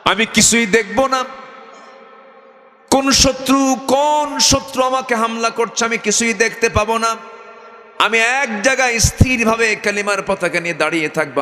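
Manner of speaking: retold vow in high waz chant; soaring sung-sermon delivery; melodic preaching.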